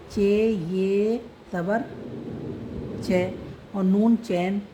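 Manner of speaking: very slow, each letter of चैन drawn out; slow spelling. Shown as a demonstration. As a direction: spell चे ये ज़बर चै, नून चैन slowly. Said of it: "तो उच्चारण यहाँ बदलता है".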